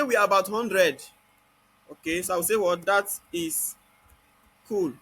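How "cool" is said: normal talking voice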